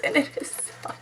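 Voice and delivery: barely voiced, very soft whisper; whispered rave, held back; hushed gushing. Real, not acted. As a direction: drop to an awed whisper. Gushing over body butter.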